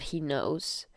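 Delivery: plain reading voice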